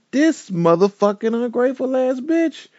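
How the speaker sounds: storyteller reacting to betrayal in a high outraged voice; outraged thought. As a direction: spit out the betrayal. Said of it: "I'm like".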